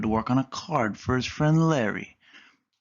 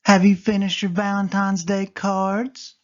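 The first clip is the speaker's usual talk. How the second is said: put-on higher, louder girl cat voice; Callie's voice; 8 semitones higher, 6 dB louder than usual. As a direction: give Callie an eager lift. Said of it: "asked Callie".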